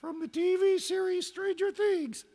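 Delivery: very high squeaky put-on dog voice; high-pitched cartoon pup; talking dog voice. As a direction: answer as a dog in a high, drawn falsetto.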